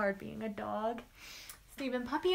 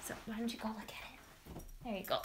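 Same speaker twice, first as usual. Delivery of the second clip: coaxing the dog; 7 dB quieter than usual. Real, not acted.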